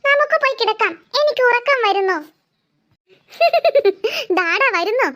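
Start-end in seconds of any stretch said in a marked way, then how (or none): 3.4-4.3 a short laugh that rises high; bright laugh. Acted. Character laughs at the end. 4.4-5.1 laughing reply. Acted. Answering while still laughing.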